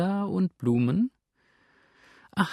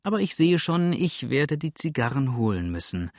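plain reading voice

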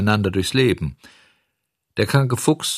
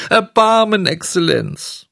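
tearful pleading; 10 semitones higher, 5 dB louder than usual. A narrator's reading. Puppet offering the highest title to the director, pleading. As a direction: cry out the grandest plea. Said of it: "weinte und flehte".

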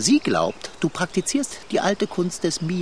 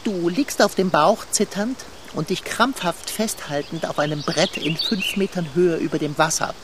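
ordinary delivery

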